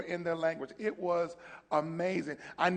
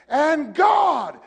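high ringing preacher's call; preaching shout; 9 semitones higher, 12 dB louder than usual. Retold or imitated. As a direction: belt it like a sermon peak. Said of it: "you know, I would say".

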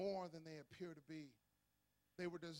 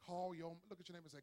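ordinary talk, nothing marked

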